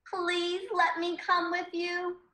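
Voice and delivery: high, slow plea; pleading child character. Acted. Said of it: "Then Iris was like".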